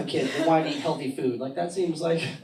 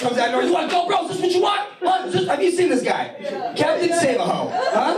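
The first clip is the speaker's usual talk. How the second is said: mock macho shout; 11 semitones higher than usual. Comic shouting at the club guy who flies in, hammed-up. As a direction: puff up and yell like a club tough.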